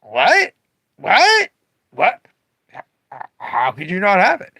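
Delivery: disbelieving shock that it's gone; acted outrage. Owner acting the shocked customer he wants, loud disbelief. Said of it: "for them not to go".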